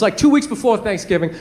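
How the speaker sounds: usual speaking voice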